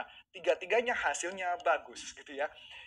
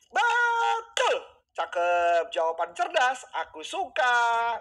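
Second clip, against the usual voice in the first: a burst of delighted shouting, much higher and louder; excited praise; 7 semitones higher, 8 dB louder, much slower than usual. Real, not acted. Coach praising viewer's smart chat answer, thrilled shouting. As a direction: cheer the right answer like a game show host.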